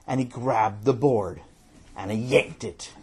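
ordinary delivery

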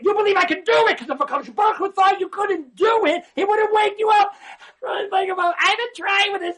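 high, loud preacher's cry; fiery preaching. Real, not acted. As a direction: lift the room with a loud, high shout. Preaching at full force.

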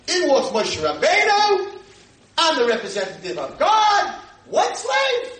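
high, loud, slow preaching cry; preaching at full force. Acted.

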